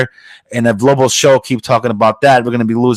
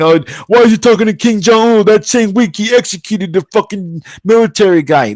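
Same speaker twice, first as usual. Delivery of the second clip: mock outraged critic; 9 semitones higher than usual.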